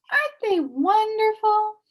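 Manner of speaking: high, loud and thrilled; delighted boy. A narrator's reading. Milo marvelling to Talk at the word stall, thrilled. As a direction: gasp with wonder at the stall.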